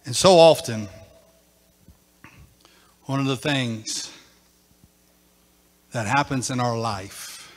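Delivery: hushed after-climax teaching; quiet reflective preaching. Acted. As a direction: bring them down gently.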